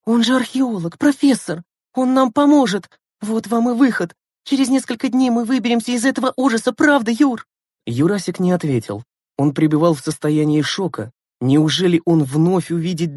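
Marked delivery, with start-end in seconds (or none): 0.0-7.5 put-on very high, delighted girl's voice; joyful exclamation. A narrator's reading. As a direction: pour out the good news, pitched way up. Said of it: "радостно воскликнула Лена". (радостно, высоко и оживлённо)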